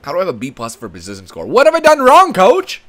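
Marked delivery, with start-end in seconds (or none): 1.4-2.8 high, loud plea to the coach; indignant protest. Pretend. Player to coach after being benched, indignant. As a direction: protest loudly, voice jumping up.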